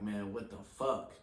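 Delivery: ordinary talk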